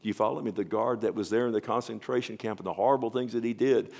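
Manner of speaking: ordinary delivery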